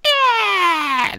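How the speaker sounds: very high villainess screech; long piercing mock wail; Rita. Shown as a demonstration. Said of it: "I do Rita. I do Rita".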